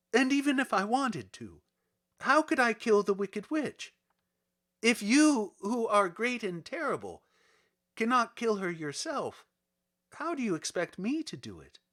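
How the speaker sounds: put-on pleading little girl Dorothy. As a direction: plead that the task is impossible.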